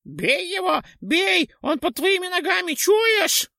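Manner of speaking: very high, loud ghoul shriek. A narrator's reading. Ghoul shrieks at the knight to strike, very high. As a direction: scream the order to strike.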